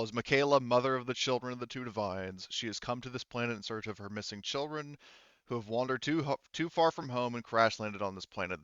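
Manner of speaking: ordinary delivery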